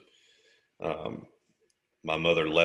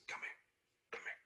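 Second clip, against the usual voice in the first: whispered beckon; 20 dB quieter than usual. Retold or imitated. Injured teen beckoning EMT close, whispered. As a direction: draw him in with a hush. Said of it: "I said".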